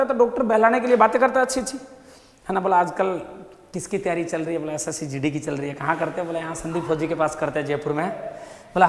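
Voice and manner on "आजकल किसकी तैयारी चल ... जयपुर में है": distracting small talk. Doctor distracting patient with chat.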